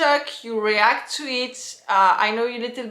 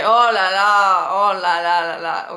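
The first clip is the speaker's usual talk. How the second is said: really surprised exclamation; 3 semitones lower, 6 dB louder than usual. Shown as a demonstration. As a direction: exclaim big, then tumble through extra las. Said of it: "when you're really surprised".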